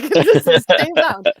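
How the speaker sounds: loud, high yell breaking into laughter; giggly mock yelling; retold yelling with laughter. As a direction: laugh while replaying your shout at the screen. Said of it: "I just keep yelling".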